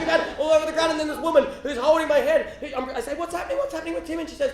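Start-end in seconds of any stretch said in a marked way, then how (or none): 3.1-4.2 high, anxious questioning; worried question. Retold or imitated. Worried boy asking the church woman about his brother, high-pitched.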